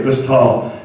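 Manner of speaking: usual speaking voice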